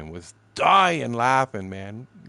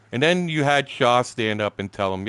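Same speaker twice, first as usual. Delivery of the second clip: ordinary talk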